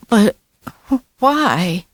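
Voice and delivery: pleading. A narrator's reading. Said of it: "Joey pleaded".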